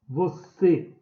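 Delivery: "Você" drawn out slowly, weight on the final CÊ; slow teaching demo, "Você" stressed on its end; stressed last syllable. Shown as a demonstration. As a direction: say "Você" as vo-CÊ for learners. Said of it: "é o C".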